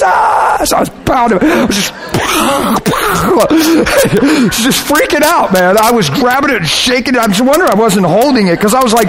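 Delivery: high, worked-up frenzied telling; frantic re-enactment. Retold or imitated. Preacher relives shaking the steering wheel, frantic and overwhelmed. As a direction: tell it as if still freaking out.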